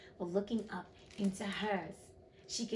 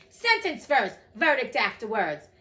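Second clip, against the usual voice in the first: imperious queen; 10 dB louder than usual. A narrator's reading. The queen demanding the sentence first, imperious. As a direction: lay down the law forcefully. Acted quarrel, queen overrules king.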